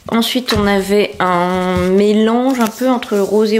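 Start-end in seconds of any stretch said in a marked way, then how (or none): none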